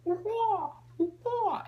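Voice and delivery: two high squawking bird cries; bird call. Retold or imitated. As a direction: imitate a bird calling out. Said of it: "I would make bird sounds".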